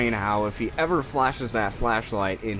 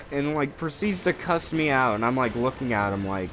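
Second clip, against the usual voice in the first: usual speaking voice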